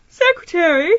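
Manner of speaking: mock innocent surprise at the secretary; surprised echo. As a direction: toss the word back as if puzzled. Feigns surprise at the question.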